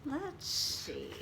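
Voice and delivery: ordinary delivery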